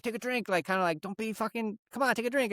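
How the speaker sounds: high, hushed cajoling; coaxing dad. Retold or imitated. Dad nudging his kid to drink at the table, coaxing. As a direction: imitate a dad egging his kid on under his breath. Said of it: "he was just like, "Come on".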